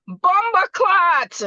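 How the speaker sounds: loud, high, drawn-out Jamaican exclamation; attempted Jamaican accent. Shown as a demonstration. Asked for some patois by listeners, playful Jamaican accent. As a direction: put on a hammy Jamaican patois shout. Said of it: "see if I can say something".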